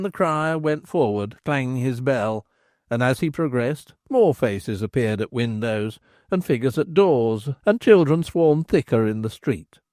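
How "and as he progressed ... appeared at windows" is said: ordinary delivery, nothing marked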